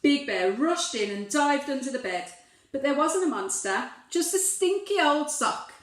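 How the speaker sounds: plain reading voice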